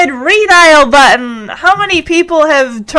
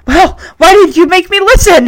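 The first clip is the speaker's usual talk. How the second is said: higher, louder exasperated comeback; indignant; 7 semitones higher, 5 dB louder than usual. Acted. Henry blames Punky after losing, indignant. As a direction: throw the blame back in a huff.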